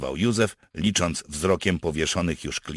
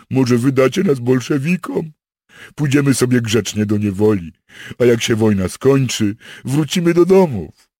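loud, high whining plea; pleading captive soldier; 8 semitones higher, 11 dB louder than usual. Acted. Captured soldier begs partisans to hand him over, pleading. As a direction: beg in a raised, loud voice.

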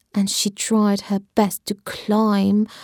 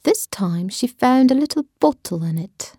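ordinary delivery, nothing marked

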